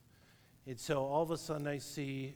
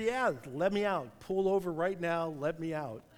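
louder, higher panicked demand to be let out; 4 semitones higher, 6 dB louder than usual. Retold or imitated. Frightened rafter demanding the guide stop the raft, raised voice. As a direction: insist on getting out now, voice raised. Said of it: "And he said".

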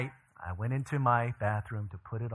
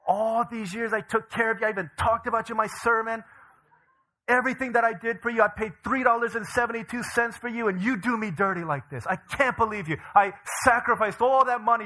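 high, mock-outraged tirade; hammed-up betrayed scolding; angry rant; 8 semitones higher, 4 dB louder than usual. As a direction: berate a traitor, overdramatic and pitched up. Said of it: "I was really angry at him".

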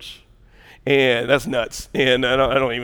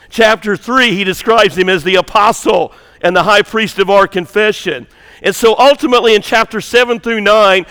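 loud, higher-pitched sermon delivery; forceful preaching; 7 semitones higher, 9 dB louder than usual. Acted. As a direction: drive the teaching home to the congregation, loud. Preaches on Hebrews with raised force.

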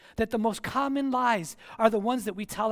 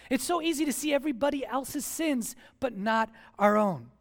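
ordinary talk, nothing marked

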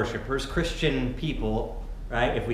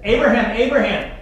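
loud, high double call of the name; urgent call; 9 semitones higher, 8 dB louder than usual. Acted. Angel calling down to Abraham from heaven, urgent loud call. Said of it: "It's an urgent emphasis in the original language".

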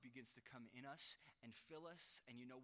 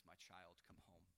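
put-on much softer and lower, gently calling a child home; tender father's call; 8 semitones lower, 9 dB quieter than usual. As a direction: speak it like a father with open arms, hushed.

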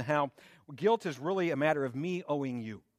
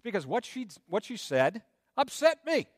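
lightly voiced defensive excuse, pitched up; a hint of a whiny self-justifier; mock excuse; 6 semitones higher than usual. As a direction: play the person making excuses. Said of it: "And you can say".

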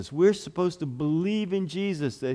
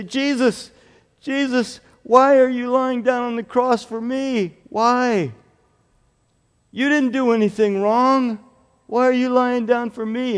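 pleading; 7 semitones higher, 4 dB louder than usual. Acted. Child pleading with Jesus.

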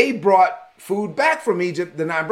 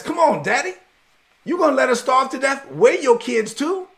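pleading son; 7 semitones higher than usual. Retold or imitated. Judah pleads with his father.

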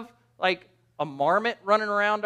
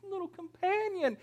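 high, soft, tiny whimpery voice; hammed-up pitiful little kitty; pleading kitten; 12 semitones higher, 7 dB quieter than usual. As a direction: beg like a lonely kitten. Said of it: "that cat looking up at 'em like".

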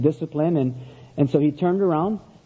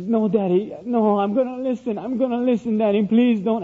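put-on higher, begging little-boy voice; pleading child; 5 semitones higher than usual. Son begs his father not to spank him, pleading. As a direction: imitate a small son begging Daddy for mercy.

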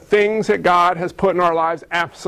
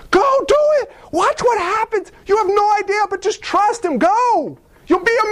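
high, fast, eager urging; excited coach to Abraham; urgent cheering on; 10 semitones higher than usual. Pretend. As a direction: push a scared friend out the door.

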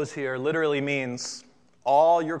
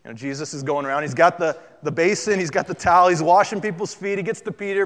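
ordinary delivery

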